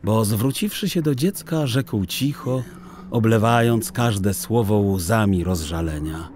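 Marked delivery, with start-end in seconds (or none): none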